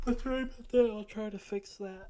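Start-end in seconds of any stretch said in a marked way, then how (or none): 0.1-0.9 words stretched through a real yawn. Real, not acted. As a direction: apologize mid-yawn, loud and open-mouthed. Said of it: "Sorry for all the yawning".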